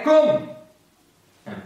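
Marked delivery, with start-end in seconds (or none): 0.0-0.5 calling out. Acted. Jesus calls Peter on water.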